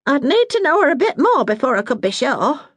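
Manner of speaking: a hint of a brisk northern mum; Bessie, motherly Yorkshire woman. Bessie speaks, cautious.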